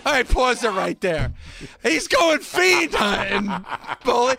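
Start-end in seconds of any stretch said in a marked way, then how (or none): 1.8-3.6 slow mocking repeat of fiend hunting. Repeating the promo line slowly to mock it. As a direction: drag out FIEND for laughs. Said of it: "I spit up my food when he said that".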